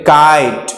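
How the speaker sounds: काइट with a long eye glide; correct pronunciation. Shown as a demonstration. Teacher gives the right reading, long-vowel काइट. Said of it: "किट या कट नहीं बोलते".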